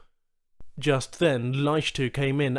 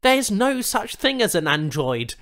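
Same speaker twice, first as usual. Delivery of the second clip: arguing; 8 semitones higher, 5 dB louder than usual. Acted. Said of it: "he argued".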